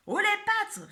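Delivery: high, loud stick-up yell; Billy repeating his shout; repeated hold-up shout. Acted. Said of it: "répète Billy".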